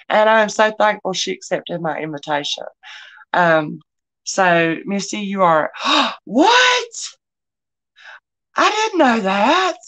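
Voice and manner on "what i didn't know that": gasp, then a much higher "what?"; stunned by the news; amazed disbelief; astonished. Real, not acted. Learns of a surprising bid total.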